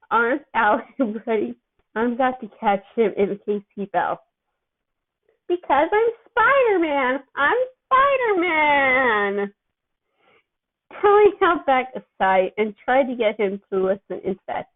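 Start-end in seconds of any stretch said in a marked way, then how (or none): 5.5-9.5 higher, very slow, loopy sing-song; a dazed kid proudly announcing himself; giddy excitement. A narrator's reading. Drugged teen thrilled on ceiling.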